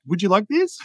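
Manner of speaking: "this?" lifted high in a hesitant question; mock a seller doubting herself, rising on "this?"; unsure rising end. Shown as a demonstration. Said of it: "they move into inflections a lot".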